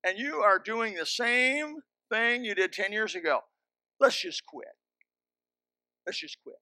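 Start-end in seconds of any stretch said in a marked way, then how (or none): 4.0-4.7 Let's raised and pushed; blunt firm Let's just quit; firm retold ultimatum. Counselor telling the husband, blunt and firm. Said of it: "I said to him". 6.0-6.6 quiet repeat. Retold or imitated. Counselor repeating it to the husband, quiet and final. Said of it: "I said to him".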